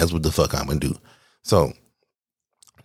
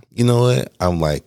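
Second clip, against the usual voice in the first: ordinary talk, nothing marked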